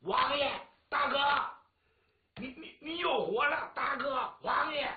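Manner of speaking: loud, high, slow calling out; shouting to the revived elder brother; joyful shouting. Acted. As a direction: cry out in disbelieving joy. Overjoyed calling the revived lord.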